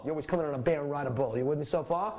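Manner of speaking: normal talking voice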